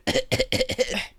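hammed-up mock sick cough; fake cough. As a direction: cough pointedly as a feeble excuse. Fake cough in mocked excuse.

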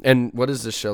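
usual speaking voice

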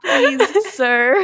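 high-pitched, pleading mock British voice; mock British accent. Host imitates Steve's British bit, pleading mock British accent. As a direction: beg in a playful fake British accent. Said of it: "starts talking in a British accent".

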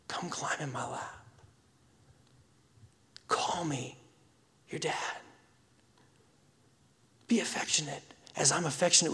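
soft, hushed voice of a loving father; God speaking gently to his children in a whisper; tender whisper. Pretend. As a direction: whisper an invitation close to the ear.

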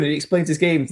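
usual speaking voice

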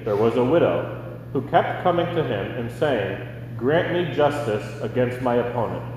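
normal talking voice

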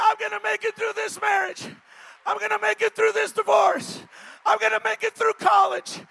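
high, full-voiced defiant yell; yelling back. Shown as a demonstration. Preacher showing the congregation how to answer, high yelling. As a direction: shout each declaration like a rallying cry. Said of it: "You ought to yell back".

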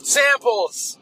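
high, eager exclaiming; excited. Real, not acted. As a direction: burst out with glee. Excited outburst.

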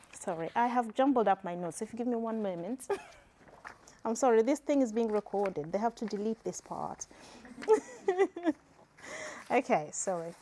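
ordinary talk

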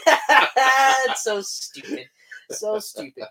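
laughing through the words; drawn out and giggly. Real, not acted. Laughing at own joke.

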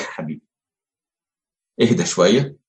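put-on calm a beloved child, softly; gentle soothing. God soothing the crying souls, tender and soft.

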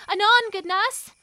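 high, loud call offstage. Acted. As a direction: call out to someone in another room. Calling offstage to nurse.

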